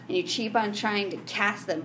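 usual speaking voice

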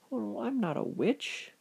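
hurt witch. A narrator's reading. Witch denying to John that she is one, high wounded protest. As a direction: plead that you are misunderstood.

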